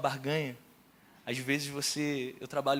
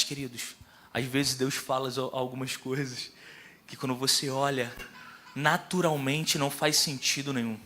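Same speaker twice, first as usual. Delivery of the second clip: normal talking voice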